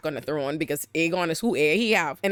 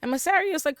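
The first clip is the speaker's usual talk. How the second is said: ordinary delivery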